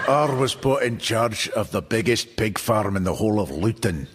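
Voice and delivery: friendly Paisley drinker boasting, lightly voiced; local pub regular. Pub regular tells his pig farm story in a light Paisley accent. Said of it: "he said".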